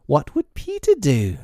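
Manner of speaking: put-on high, eager children's cry; boys crying out. A narrator's reading. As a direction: call it out like a band of boys. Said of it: "they cried simultaneously".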